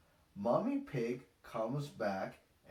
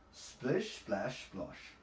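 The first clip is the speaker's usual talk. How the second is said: ordinary delivery, nothing marked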